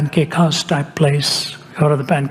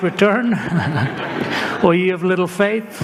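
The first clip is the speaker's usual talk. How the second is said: quoted through a laugh, higher; laughing at himself; 4 semitones higher than usual. Real, not acted. Preacher mocks his own doubt, laughing. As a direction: tease yourself while laughing. Self-mocking about fearing relapse.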